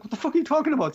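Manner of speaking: high, loud what the fuck; outraged retort. Retold or imitated. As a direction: cut off the coordinator in disbelief. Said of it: "And he's like".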